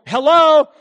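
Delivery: loud, high hello down the line; calling out hello. Retold or imitated. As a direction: shout hello to someone who can't hear. Calling into phone, unheard.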